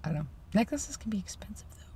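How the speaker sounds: hushed whisper. Real, not acted. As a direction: whisper it to yourself. Whispered musing.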